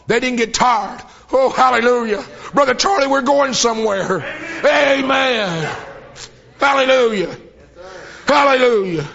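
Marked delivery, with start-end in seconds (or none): 1.3-9.1 preaching at full force. Real, not acted. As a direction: lift the church with joyful hallelujahs. Preacher rising to exultation.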